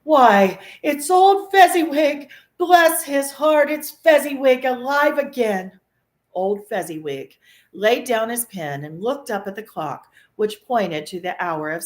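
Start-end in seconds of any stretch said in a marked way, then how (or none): 0.0-5.8 much higher, louder joyful cry; great excitement. A narrator's reading. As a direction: burst out in delighted recognition. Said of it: "cried in great excitement".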